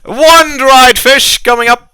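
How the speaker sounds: brisk acknowledgement rising to a high shout; confirm, then holler the order to the kitchen; loud tavern host calling out a dish; bartender taking an order. Acted.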